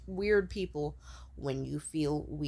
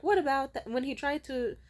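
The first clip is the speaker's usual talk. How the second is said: higher, louder inner voice butting in; pointed reminder, pitched up; nagging intuition; 6 semitones higher, 5 dB louder than usual. Acted. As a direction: play intuition raising an objection. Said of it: "my intuition would be like".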